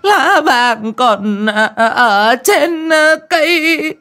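high, loud, drawn-out funeral keening; wailing lament. A narrator's reading. Mother mourning her son beside his coffin, wailing. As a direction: wail slowly in long sing-song cries. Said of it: "mồm ê a".

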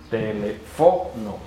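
telefono very slowly, te-le-FO-no; Esperanto stress. Shown as a demonstration. Teacher repeats for the class, telefono slowly stressed on FO. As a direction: split telefono up, long FO. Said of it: "es telefono".

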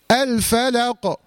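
drawn-out "الفلق" with a bounced final ق; correct qalqala. Shown as a demonstration. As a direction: stretch "الفلق" slowly and pop the ق at the end. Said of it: "يبقى أقولها إزاي؟". ("الفلق" ببطء مع قلقلة القاف في آخرها)

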